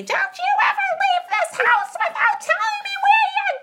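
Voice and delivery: piercing, very high angry screech; mother screaming. Retold or imitated. Mother scolding daughter for leaving without telling, hysterical screaming. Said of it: "screaming at me".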